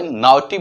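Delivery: नॉटी with "ow" in place of "aw"; wrong "au" sound. Shown as a demonstration. Teacher repeating the mistake to avoid, said "now-tee". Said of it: "नहीं बोल के हम".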